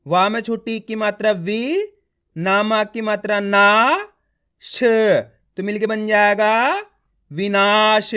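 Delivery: teacher's slow build-up to a drawn-out "विनाश"; letter-by-letter sounding out. Shown as a demonstration. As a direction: rise on "वी", stretch "ना" slowly, isolate "श", then blend "विनाश". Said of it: "एक एक अक्षर का उच्चारण करके पढ़ रहा हूँ".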